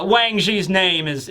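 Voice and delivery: ordinary talk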